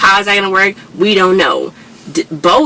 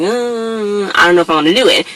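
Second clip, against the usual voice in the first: reluctant; 3 semitones higher than usual. Retold or imitated. Unsure about doing it tonight, mildly reluctant. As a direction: mildly imitate someone unwilling to commit. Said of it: "kinda feeling like".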